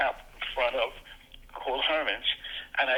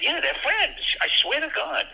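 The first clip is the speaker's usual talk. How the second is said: put-on much higher, breathless thrilled kid voice; giddy excitement; 14 semitones higher than usual. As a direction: gush like a thrilled child, half whispered. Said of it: "I said".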